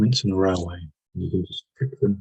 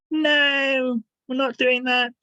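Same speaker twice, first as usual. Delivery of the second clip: squeaky cartoon voice, much higher; silly high-pitched voice; slower than usual. Shown as a demonstration. To the listener, showing a silly inner voice: squeaky and much higher. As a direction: make the inner voice a funny high character. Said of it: "crazy and silly, high-pitched".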